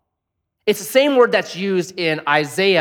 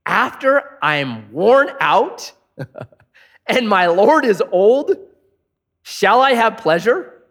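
slow amused disbelief with a laugh; laughing to herself; 5 semitones higher, 4 dB louder, slower than usual. A narrator's reading. Sarah laughing to herself at the promise, slow and amused. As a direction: read Sarah chuckling at the idea. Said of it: "So Sarah laughed to herself".

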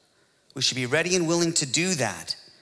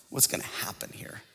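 hushed, whispered wondering; under-the-breath whisper; whispered aside; 4 semitones lower, 10 dB quieter than usual. Retold or imitated. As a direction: whisper it like a private worry. Aside voicing his private worry.

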